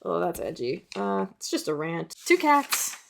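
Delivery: ordinary delivery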